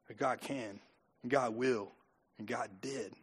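very soft, low assurance with pauses; hushed conviction. Real, not acted. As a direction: drop right down and let each phrase settle. Hushed climax after forceful preaching.

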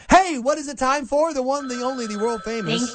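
booming announcer build-up; ringmaster. Acted. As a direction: hype up the segment like a showman. Announcer intro for segment.

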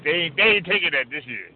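usual speaking voice

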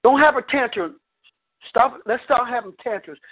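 ordinary delivery

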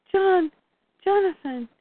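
put-on high-pitched call for a child; distressed calling. Retelling injured Lois calling for her son, distressed calling. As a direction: call out for your missing son.

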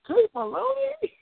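very high, beaming call of her name; delighted greeting. Pretend. Tony Robbins greeting the client, very high and delighted.

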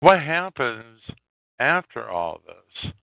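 ordinary delivery

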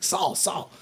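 urgent calling out. Acted. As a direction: call her name in a hurry. Halfling arrives calling for Saul.

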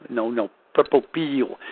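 slow southern drawl on purple peel, peel said like pill; southern accent. Retold or imitated. Correcting a friend in a slow southern accent. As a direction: imitate a southern man saying purple peel slowly. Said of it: "He had an accent, a southern accent".

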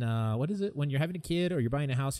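usual speaking voice

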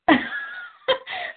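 high breathy burst of laughter; quick high laugh. Real, not acted. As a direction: laugh out at the memory. Laughs at her own story.

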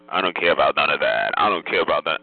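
very low mocking voice; dropped throat. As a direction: drop deep into the throat to mimic. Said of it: "when that throat dropped and it said".

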